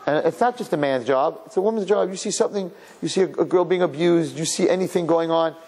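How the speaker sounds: normal talking voice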